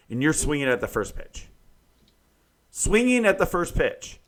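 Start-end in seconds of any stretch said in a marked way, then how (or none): 2.7-4.2 higher, louder exasperated repeat. Real, not acted. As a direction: hammer it home in disbelief. Repeats the complaint with emphasis.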